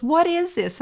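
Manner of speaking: playing a bewildered city lady; baffled tourist. Tourist asking a farmer about soybeans, high and baffled. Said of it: "She goes".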